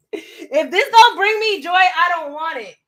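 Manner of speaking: laughing at her own Kondo line; laughing repeat. Retold or imitated. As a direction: say it again, cracking up. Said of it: "because you're like".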